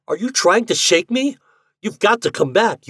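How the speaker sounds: high, loud and insistent; roared protest. A narrator's reading. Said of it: "roared Ford".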